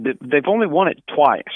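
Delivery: usual speaking voice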